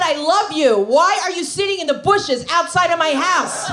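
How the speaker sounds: loud, high pleading yell; exasperated shout. Retold or imitated. Girlfriend yelling at boyfriend hiding in her bushes, loud and high. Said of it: "I said".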